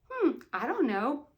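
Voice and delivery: ordinary delivery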